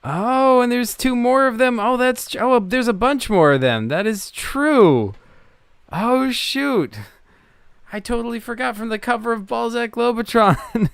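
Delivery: high, thrilled voice of sudden recognition; light up as you spot it, pitch way up; delighted, high-pitched realization; real excited discovery. Real, not acted. Player discovers the forgotten ships on website.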